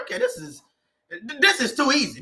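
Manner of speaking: high, airy delighted realization; breezy happy disbelief; pleased surprise. Retold or imitated. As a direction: marvel at how easy it is. In purpose, work feels easy.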